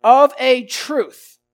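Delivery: slow, loud and weighty; emphatic. Acted. Bystander declaring Peter's guilt, slow and loud. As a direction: declare it with full certainty. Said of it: "with no doubt of true sincerity".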